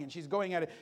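normal talking voice

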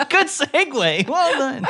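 laughing high through the words; giggling at the segue, words slow and high. Real, not acted. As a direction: praise the segue while still laughing. Laughing at the joke.

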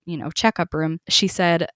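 ordinary talk

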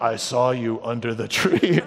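low, slow stalker voice; ominously slow, low-pitched lurker; creepy stalker. Acted. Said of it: "Jesus as creepy stalker".

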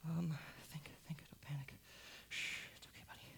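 breathless whisper to himself; frightened whisper. Acted. Cornered father whispering to himself to keep calm. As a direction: hush his own panic.

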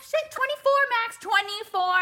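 high, loud panicked correction; flustered outburst. Retold or imitated. Mom hushing her toddler in public, high and loud. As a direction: blurt it out quickly to cover up.

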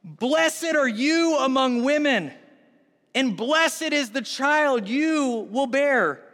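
high, slow, ringing proclamation; loud voice. Acted. Elizabeth blessing Mary, loud slow proclamation. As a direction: proclaim each phrase slowly and loudly. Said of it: "In a loud voice, she said".

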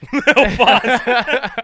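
laughing high and loud through the name. Real, not acted. Laughing repeat.

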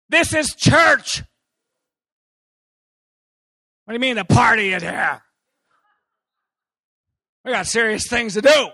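high, loud, scandalized protest; huffing that church is serious business; mock indignant outburst. Preacher mocking his old self, indignant shout. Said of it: "I was uncomfortable".

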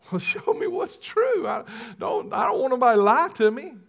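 high, fast mock insistence; insistent demand. Preacher retelling his demand to God, high and insistent. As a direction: push back hard, half joking.

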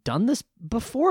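high, doubtful boss asking; skeptical manager voice. Retold or imitated. Manager questioning young bartender.

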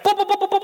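high, repeated booping like a clanging bell; playful high cowbell noises; mock cowbell booping. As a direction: boop it up high like the cowbell going off. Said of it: "It was like a flipping cowbell".

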